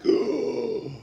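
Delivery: high, loud shocked "Oh!"; a schoolyard crowd reacting to the slap. Kids in the schoolyard after the slap, shocked. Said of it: "Everybody was like".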